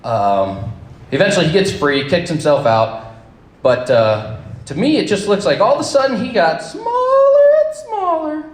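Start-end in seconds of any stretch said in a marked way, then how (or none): 4.7-8.4 astonished shout. Real, not acted. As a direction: cry out in sudden shock. Chute gone, partner falls away.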